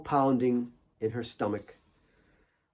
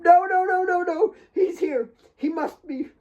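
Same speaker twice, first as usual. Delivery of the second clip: high, tearful no no no; high desperate denial fading; frantic denial. A narrator's reading. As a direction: refuse to believe it.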